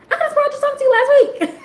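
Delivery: high, loud incredulous protest; laughing disbelief. Acted. Speaker hears it's been three weeks, high laughing disbelief. Acted disbelief at time passed.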